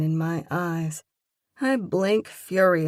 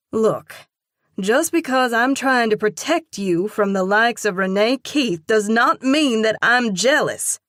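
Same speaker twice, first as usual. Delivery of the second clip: louder and higher, exasperated; heated denial to Keith, louder and higher; heated outburst; 5 semitones higher, 8 dB louder than usual. A narrator's reading. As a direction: protest too much, loudly.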